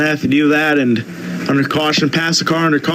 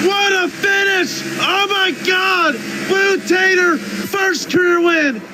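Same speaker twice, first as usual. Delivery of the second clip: much higher, stretched out in disbelief; ecstatic race call; 14 semitones higher than usual. Real, not acted. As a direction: sell the last-turn finish with everything. Photo finish, first career win.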